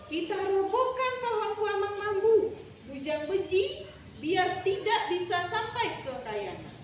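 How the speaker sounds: high-pitched, forceful storybook animal; rally the troop against him. Acted. Rayap dan beruang marah, bersekongkol. (seruan hewan bernada tinggi)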